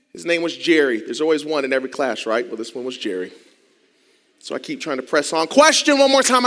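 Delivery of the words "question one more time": called-out interruption. Retold or imitated. Classmate calls out mid-presentation.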